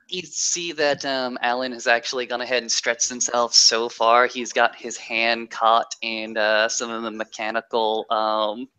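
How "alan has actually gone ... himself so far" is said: normal talking voice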